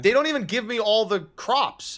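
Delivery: mock outrage. Joking rant about lazy mammoths.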